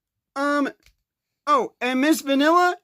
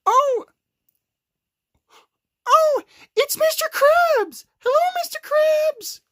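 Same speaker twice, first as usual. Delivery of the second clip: put-on much higher sweet little girl; little rabbit girl voice; 13 semitones higher than usual. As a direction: greet him up high like a tiny girl. Cream greets Mister Krabs.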